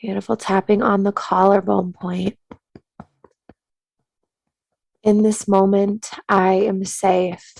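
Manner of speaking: soothing meditation-teacher cadence; guided tapping meditation. Acted. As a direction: calmly guide them to tap and breathe.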